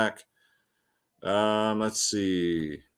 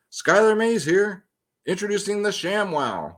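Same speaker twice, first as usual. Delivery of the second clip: infomercial pitchman; 8 semitones higher than usual. Pretend. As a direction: sell it like a late-night ad. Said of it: "Sound like a damn, uh, infomercial".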